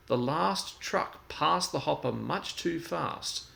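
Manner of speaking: ordinary delivery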